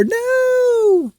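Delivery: very high squealed No; a hammed-up high-pitched squeal of No; mock shriek.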